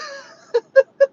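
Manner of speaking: very loud, high peals of laughter; helpless laughter. Real, not acted. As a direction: break off into uncontrollable laughing. Amused at her moderator mistake.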